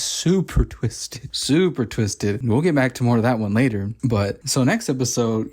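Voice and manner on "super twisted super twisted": slow emphasis. Real, not acted. Emphatic repeat, slowed.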